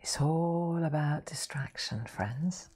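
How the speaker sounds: slow confiding whisper. Real, not acted. Telling friends watching her paint, slow confiding whisper. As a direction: let the viewers in on a secret.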